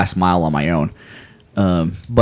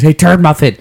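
playful call, higher and louder; yelling down stairs; 7 semitones higher, 5 dB louder than usual. Retold or imitated. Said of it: "So I just yell down the stairs".

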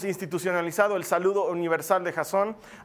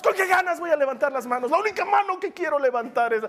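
high-pitched, hammed-up exasperation; mock indignant grumbling; 8 semitones higher than usual. Churchgoer told to raise hands after a fight, indignant. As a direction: complain as if still fuming from the fight.

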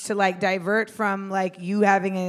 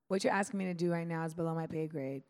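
softer, polished office voice; professional tone; 3 semitones lower, 7 dB quieter than usual. Shown as a demonstration. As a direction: say it like a polite professional. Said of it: "in the professional environment".

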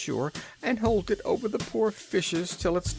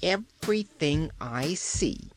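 surly; 6 semitones higher, slower than usual. A narrator's reading. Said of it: "in a more surly tone".

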